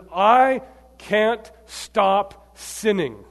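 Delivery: slow and loud, word by word; anguished confession. Retold or imitated. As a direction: hammer out each word in confession. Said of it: "this is the way he writes it".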